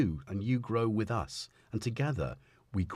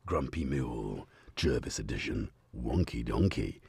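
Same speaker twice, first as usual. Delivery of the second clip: much lower, measured product-name read; mock brand announcer; 9 semitones lower, 3 dB quieter than usual. Streamer inventing a coffee brand name, mock advert voice. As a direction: drop low to announce the brand.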